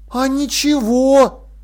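slow, high drawl. A narrator's reading. Jern looks round the chamber, drawling. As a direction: stretch the words right out. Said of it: "протянул он".

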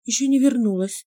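high strained choked voice; choked reply. A narrator's reading. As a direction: squeeze it out through a choked throat. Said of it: "с трудом ответила Ма".

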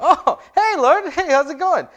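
hammed-up startled greeting; caught-out yelp. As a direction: squeal like you got caught. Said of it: "I'm going".

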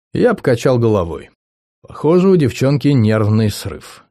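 ordinary delivery, nothing marked

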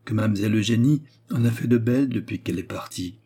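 ordinary delivery

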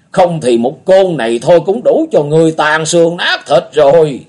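put-on high, loud old man's exclamation; a startled old man crying out; exclaiming old beggar. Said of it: "Bỗng lão kêu lên".

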